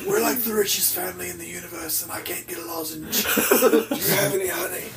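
dry, hoarse throat. Real, not acted. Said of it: "Why is my throat so dry?".

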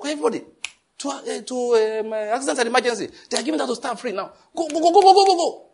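hammed-up panicked shouting; frantic urging. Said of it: "He came with a panicking voice".

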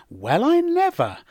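put-on high swooping old-fashioned astonishment; politely scandalised, drawn out and high. Shown as a demonstration. Teacher mimics quaint English surprise, politely astonished. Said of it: "very polite and old-fashioned way of saying".

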